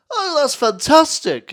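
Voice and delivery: high, loud, slow gushing host; overjoyed host not listening at all; mock Chris Tarrant beaming; Chris Tarrant impression. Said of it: "He goes".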